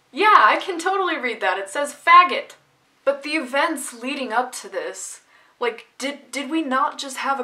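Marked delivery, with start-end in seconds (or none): 0.1-2.6 high, loud joking retort; mock playful comeback. Playing along with the trainer's joke, high and loud mock comeback. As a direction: toss back the joke with gusto. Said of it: "I would have been like".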